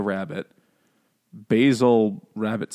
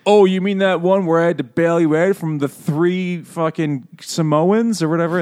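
loud, higher mock action-movie banter; eighties action buddy; 6 semitones higher, 5 dB louder than usual. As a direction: shout small talk mid-brawl. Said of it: "action movie conversations from the eighties".

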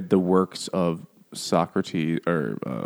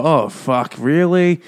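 high, loud mock alarm; mock surprise; 7 semitones higher, 5 dB louder, slower than usual. Crew learns he'll explode, mock shocked. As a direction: jump up in pitch, shocked. Crew reacts to bomb news.

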